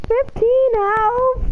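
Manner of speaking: disbelief. Real, not acted. Repeating in disbelief.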